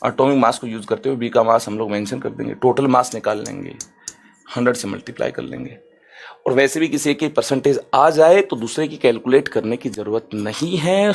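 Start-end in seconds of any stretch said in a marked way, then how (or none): 4.5-5.8 whisper. Real, not acted. Whispered end of the steps.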